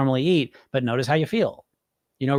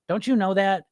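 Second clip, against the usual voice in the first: normal talking voice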